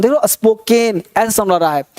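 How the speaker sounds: ordinary talk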